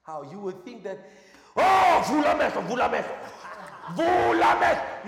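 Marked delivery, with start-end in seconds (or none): 1.6-5.1 loud, higher shouted command, mocking; hammed-up mock shouting of healing command; mock forceful preacher. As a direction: shout it like a preacher forcing a miracle. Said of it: "It's just people trying to force it".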